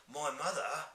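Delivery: surprised cry. Acted. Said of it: "cried the B F G".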